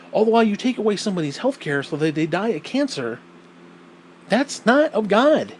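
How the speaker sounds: usual speaking voice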